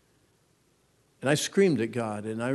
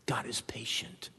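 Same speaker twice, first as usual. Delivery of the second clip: hushed; 11 dB quieter than usual. Pretend. Dad sharing devotion with kids.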